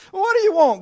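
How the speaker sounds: high, loud exasperated outburst. Acted. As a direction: throw the question up at God. Acted exasperated demand to God.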